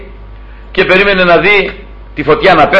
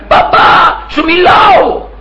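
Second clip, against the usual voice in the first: put-on little child's voice; much slower than usual. Preacher mimicking a small boy shouting at his dad, high little voice. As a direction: play a toddler demanding his father's attention. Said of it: "φώναζε με τη φωνούλα του".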